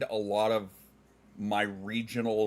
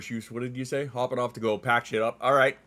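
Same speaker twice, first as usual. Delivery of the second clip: ordinary talk, nothing marked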